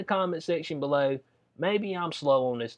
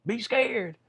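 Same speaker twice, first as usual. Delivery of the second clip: higher, sarcastic doom-saying; mocking alarm; 8 semitones higher than usual. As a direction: parody of officials scaring people. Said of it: "and then they be like".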